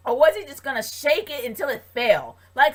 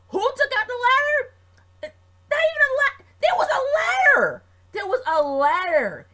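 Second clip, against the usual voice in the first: outraged shout; 13 semitones higher, 5 dB louder than usual. Real, not acted. Outraged rant about the ladder.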